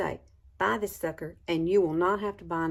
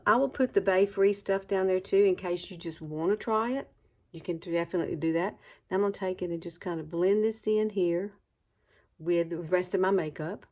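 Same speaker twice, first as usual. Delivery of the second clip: ordinary talk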